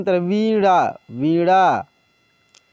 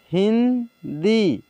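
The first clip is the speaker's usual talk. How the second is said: Hindi stretched out very slowly, sound by sound; slow teacher dictation of Hindi; slow spelled-out reading; much slower than usual. Shown as a demonstration. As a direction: draw out Hindi slowly for the class. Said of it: "teacher reads word slowly".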